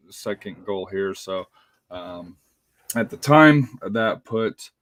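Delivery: usual speaking voice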